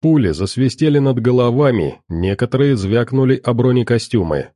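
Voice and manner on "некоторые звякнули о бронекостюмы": ordinary delivery, nothing marked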